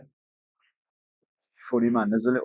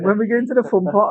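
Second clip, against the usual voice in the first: put-on kids whining; 8 semitones higher, 4 dB louder than usual. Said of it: "they kept saying".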